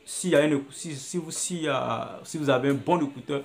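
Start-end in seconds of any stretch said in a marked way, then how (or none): none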